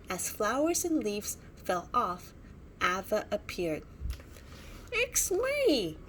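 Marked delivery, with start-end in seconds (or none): 4.9-6.0 spluttering. A narrator's reading. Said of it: "spluttered Ava".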